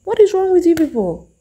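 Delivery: high, loud exasperated cry; a loud, disbelieving what-is-wrong; exasperated outburst. Retold or imitated. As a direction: throw up your hands at them. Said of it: "And I'm like".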